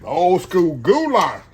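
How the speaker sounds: slow, loud showman call; dish announcement. Real, not acted. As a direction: announce it like a show title.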